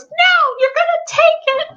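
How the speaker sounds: high child voice, mock defiant; kid refusing. Son refusing to give back the kazoo, childish. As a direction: play a stubborn little boy. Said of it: "the kid goes".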